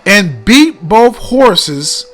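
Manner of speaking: dramatic reading aloud. Shown as a demonstration. Reads the letter aloud.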